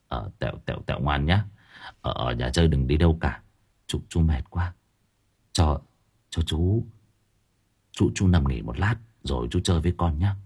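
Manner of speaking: a sick man barely getting the words out; exhausted feverish uncle. Acted.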